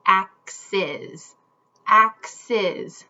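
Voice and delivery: "Axes." said slowly in two clear beats, ax-es; syllable demo. Shown as a demonstration. Teacher has kids feel the two syllables of "Axes.", slow and deliberate. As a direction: stretch "Axes." so both syllables land. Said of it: "Let's feel it".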